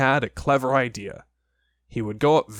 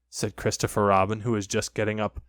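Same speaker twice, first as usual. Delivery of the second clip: ordinary delivery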